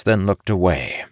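plain reading voice

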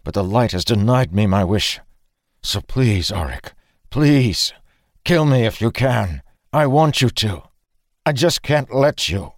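pleading, pitch climbing as it goes; desperate plea. A narrator's reading. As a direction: build from confession to high, anguished begging. Pleading to be killed.

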